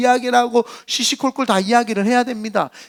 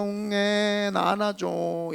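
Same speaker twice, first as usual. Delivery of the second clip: put-on softer, hushed baby pleading; low-voiced plea; 9 dB quieter, much slower than usual. Preacher voicing a baby asking its parent to hold it, softly. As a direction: ask to be picked up in a small, quiet baby voice. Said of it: "이 낮은 목소리로".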